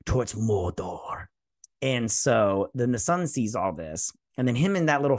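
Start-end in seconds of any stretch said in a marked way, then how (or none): 0.1-1.3 mock ominous. Mock-ominous reveal of the place name.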